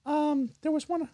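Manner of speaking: silly put-on lady voice; goofy character voice. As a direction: play a goofy woman character. Holds put-on voice.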